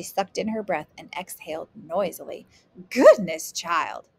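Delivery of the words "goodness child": exclaiming. A narrator's reading. Grandmother exclaiming at the cost, taken aback. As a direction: burst out in shock. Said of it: "she exclaimed".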